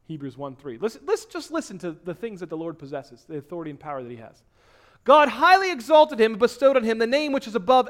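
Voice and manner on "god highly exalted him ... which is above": proclaimed scripture. Acted.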